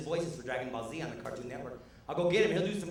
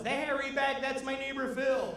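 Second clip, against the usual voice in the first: much higher, amazed voice; surprised fan; 12 semitones higher, slower than usual. Retold or imitated. A fan recognizing the unmasked wrestler, much higher voice. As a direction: gasp it out like a sudden recognition.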